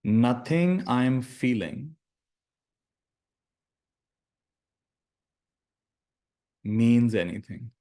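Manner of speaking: slow, deliberate meditation phrases with long silences; guided meditation. Acted. Teacher giving students lines to repeat in their minds, slow meditative delivery. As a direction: lead them slowly through each phrase, leaving space to repeat it.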